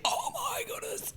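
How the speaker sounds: high, breathy whispered gasp of amazement; amazed tasters. Retold or imitated. Tasters at an event tasting the Durif, whispering in astonishment. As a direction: gasp it out in hushed wonder. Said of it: "tasted our wine ... and went".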